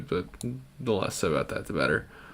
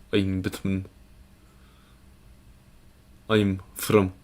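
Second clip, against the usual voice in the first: put-on slow Russian-accented superhero, rolled r; Russian accent, halting and deliberate; Russian Batman. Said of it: "it just kinda comes out weirdly".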